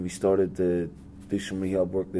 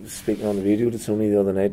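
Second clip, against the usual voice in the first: ordinary talk, nothing marked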